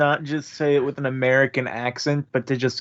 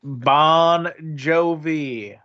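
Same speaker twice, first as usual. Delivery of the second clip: slow mock greeting; slower than usual. Player joking mock alien greeting.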